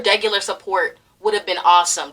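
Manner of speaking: normal talking voice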